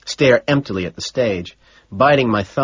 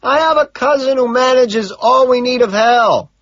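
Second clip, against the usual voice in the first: sustained high yell; loud and high throughout; shouting; 7 dB louder than usual. A narrator's reading. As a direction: holler the explanation over a din. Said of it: "he shouts".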